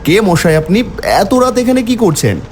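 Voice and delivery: ordinary delivery, nothing marked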